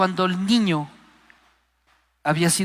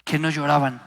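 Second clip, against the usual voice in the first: ordinary talk, nothing marked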